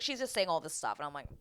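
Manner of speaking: normal talking voice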